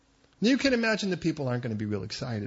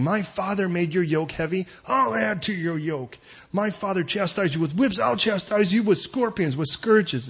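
loud, raised-pitch threat; rough answer; 5 semitones higher, 5 dB louder than usual. Acted. Young king answering the people's plea, rough and forceful. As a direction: declaim it as a harsh royal threat. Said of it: "the king answered the people roughly".

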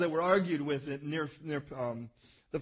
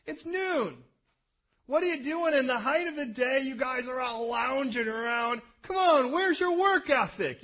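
mock scolding; 4 semitones higher, 4 dB louder than usual. Voicing Jacob scolding loungers.

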